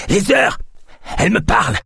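panicked seer crying out a warning; frantic outburst. Acted. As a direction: shriek the prophecy in terror. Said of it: "Alertés par son éclat de voix".

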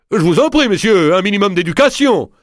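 voix de Garamond. Acted. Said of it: "un détail aussi grotesque".

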